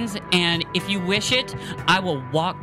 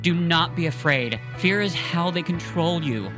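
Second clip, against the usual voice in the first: higher, earnest pleading; Matthias, pleading; 4 semitones higher than usual. Acted. Matthias pleads with the scared boy, higher and earnest.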